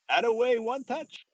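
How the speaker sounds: put-on high, loud French-accented teammate. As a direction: tease him in a French accent. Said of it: "Benny goes in his French accent".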